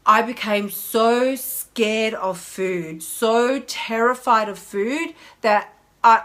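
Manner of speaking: slow, heavy confession; slow and deliberate. Real, not acted. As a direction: dwell on each word slowly. Confessing fear of food.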